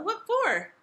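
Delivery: very high, loud challenge; pert, high-pitched retort; defiant question. A narrator's reading. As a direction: challenge the king like a cheeky child. Princess defies the king.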